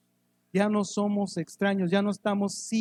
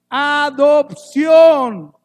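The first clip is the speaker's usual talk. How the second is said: adopción stretched very slow, much higher and louder; the key word of the verse, held long and loud; drawn-out emphasis; 7 semitones higher, 13 dB louder, much slower than usual. Acted. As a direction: lean hard on adopción, stretching every syllable. Climax of the verse.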